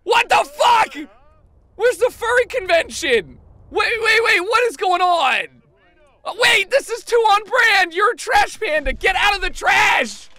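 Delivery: high, loud, incredulous yelling; alarmed shouting. Real, not acted. Player reacting to game chaos, shouting in alarm. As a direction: yell in disbelief as it all goes wrong.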